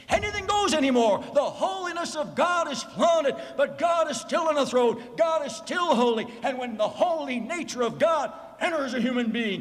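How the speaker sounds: high, ringing preacher's proclamation; full-force preaching. Real, not acted. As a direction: proclaim it to the congregation, pitched high.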